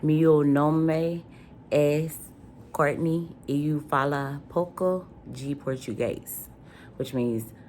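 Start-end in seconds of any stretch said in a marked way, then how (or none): 0.0-6.5 Portuguese phrase. Shown as a demonstration. Vlogger demonstrating her self-introduction, Portuguese accent. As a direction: introduce yourself carefully in Portuguese. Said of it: "Or I'll say".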